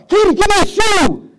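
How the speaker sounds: high, loud, slow shout; husband yelling for a shoe, high and loud. Retold or imitated. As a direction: bellow it down the hall. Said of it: "I yelled at her".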